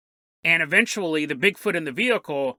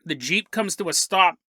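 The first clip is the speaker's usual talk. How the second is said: ordinary talk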